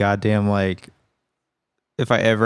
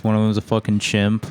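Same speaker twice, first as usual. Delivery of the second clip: normal talking voice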